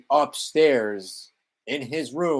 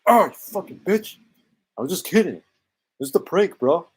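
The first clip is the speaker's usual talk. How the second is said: higher, louder jokey prankster voice; mock Michael voice; 7 semitones higher, 6 dB louder than usual. Mocking Michael after being hit, as a whiny prankster. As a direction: play a hurt dude saying it was a joke. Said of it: "It's like".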